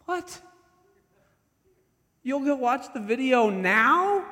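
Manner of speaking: can't believe what he just heard; incredulous outburst. Retold or imitated. Coach stunned at the detective, shouting in disbelief.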